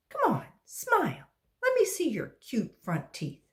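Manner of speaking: high teasing sing-song; mock teasing. Louis teasing Rondi about her teeth, high mocking.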